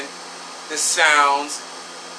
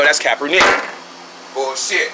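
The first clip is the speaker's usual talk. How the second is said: ordinary delivery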